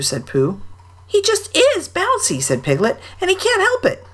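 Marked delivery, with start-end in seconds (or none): 1.1-4.0 squeaky little Piglet; tiny, high and timid. A narrator's reading. Piglet defends Tigger.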